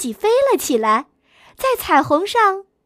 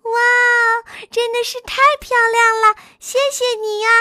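excited cry; 6 semitones higher, faster than usual. Acted. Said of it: "叫起来".

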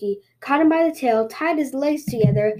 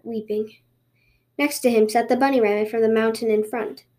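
plain reading voice

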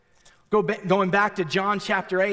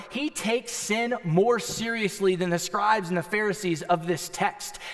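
usual speaking voice

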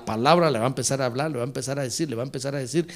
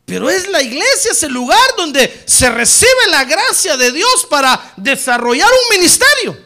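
high, loud preacher's cry, drawn out; preaching at full force; 9 semitones higher, 11 dB louder, slower than usual. Real, not acted. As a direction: proclaim it to the whole congregation. Preaching climax.

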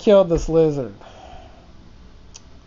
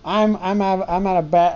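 ordinary talk, nothing marked

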